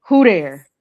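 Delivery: "Who there?" said flat and falling; knowing coded "Who there?"; certain tone. Shown as a demonstration. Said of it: "you say it in a certain tone".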